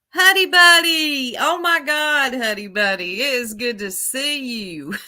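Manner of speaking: high, loud squeal settling into delight; excited greeting. Real, not acted. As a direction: greet a long-missed friend with a burst of joy. Greets a viewer joining the live.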